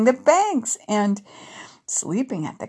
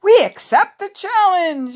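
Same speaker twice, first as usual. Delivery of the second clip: high, louder acceptance of a dare; spirited reply; 10 semitones higher, 5 dB louder than usual. Acted. Courtney answering the boys' challenge, high and spirited. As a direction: call back boldly, pitched up.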